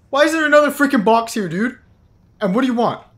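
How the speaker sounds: loud, high-pitched protest; exasperated yell. Real, not acted. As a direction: yell at whoever keeps bothering you. Yells at the game, exasperated.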